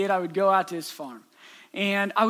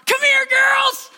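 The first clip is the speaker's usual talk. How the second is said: shout; 8 dB louder, slower than usual. Retold or imitated. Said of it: "And so I shout".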